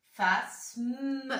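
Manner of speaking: "Phasme" stretched into "fas-mme"; two-syllable reading. Shown as a demonstration. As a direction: read "Phasme" slowly, holding the m into a final "me". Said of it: "Deux syllabes".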